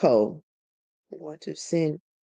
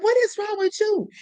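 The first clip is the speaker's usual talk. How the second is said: startled question; 14 semitones higher than usual. Retold or imitated. Neighbor bursts in screaming.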